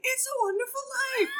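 high, loud thrilled host voice; excited restaurant owner. Retold or imitated. Owner naming her show to her in-laws, high excited mock voice. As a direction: announce it like a starstruck host. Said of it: "And he's like".

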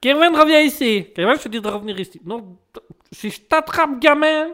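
put-on high, loud, fast scolding yell at a kid; hammed-up furious parent chasing a brat; mock angry shout. A mock parent shouting after an unbearable kid, high and loud.

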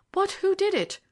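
high, loud, agitated question; demanding outcry from an agitated banker; urgent cry. A narrator's reading. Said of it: "cried Debrett".

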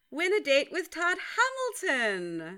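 Hamilton lifted in a rising question tune; uptalk. Shown as a demonstration. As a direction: raise the pitch up through Hamilton at the end. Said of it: "we have to do the upward inflection".

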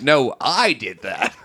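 slow smug CEO. Retold or imitated. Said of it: "who really is like".

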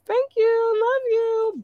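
squeaky thanks. Real, not acted. Squeaky thanks to Philippines listeners.

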